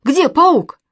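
high, loud startled question; alarmed. A narrator's reading. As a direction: ask in sudden panic. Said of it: "вдруг всполошился Костя".

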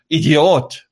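high, loud acted yell; mock outraged shout; shouted insult. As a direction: hurl the insult at full voice. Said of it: "steigt sie wieder auf und schreit".

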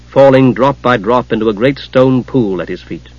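ordinary delivery

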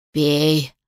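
low, brooking no argument; lightly voiced creature giving an order; commanding undead creature. A narrator's reading. Undead creature ordering a captive woman to drink, commanding. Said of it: "прошелестело существо тоном, нетерпящим возражений".

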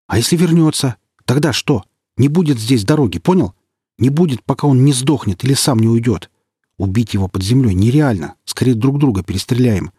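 high, loud heated arguing; heated argument. Acted. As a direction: drive the point home hotly. Comrade argues hotly.

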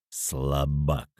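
low, quiet contempt; spiteful. A narrator's reading. Man sneers at his passed-out friend, spiteful. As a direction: drop it softly with cold malice. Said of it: "зло сказал Виктор".

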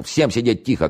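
high-pitched commanding call; shout through megaphone. A narrator's reading. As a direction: order everyone to stay put. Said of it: "крикнул шеф в рупор".

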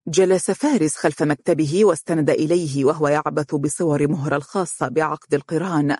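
plain reading voice

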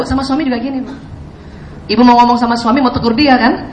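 ordinary talk, nothing marked

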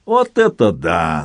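slow, drawn-out low wonder; low, stretched exclamation of delight; delighted amazement. A narrator's reading. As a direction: marvel with slow relish. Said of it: "Самсон в восторге шлёпнул руками по бёдрам".

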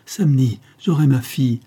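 mother overjoyed at the ransom letter, joyful. Acted. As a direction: cry it out with joy at getting her daughter back. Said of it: "lui dit-elle avec joie".

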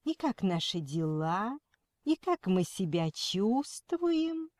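put-on syrupy baby talk, slow; kindergarten teacher voice. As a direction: coo it like a fake-caring nurse. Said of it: "она засюсюкала".

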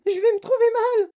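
very high, loud wail; distressed scream. A narrator's reading. Mother turning green in the lift, screaming. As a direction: shriek that you will faint. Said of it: "hurla madame T V".

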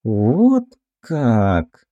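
протяжно, в раздумье; растяни слова, будто осмысляя услышанное; задумчиво протянуто. A narrator's reading. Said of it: "задумчиво протянула Бритва". (задумчиво, врастяжку)

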